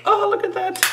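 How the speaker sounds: very high squeaky voice; squeaky silly voice. Acted. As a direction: squeak it in a cartoon voice. Silly high voice playing along.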